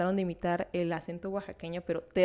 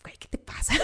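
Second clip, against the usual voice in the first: whispered aside; 4 dB quieter than usual. Retold or imitated. Her inner reaction to cartoon.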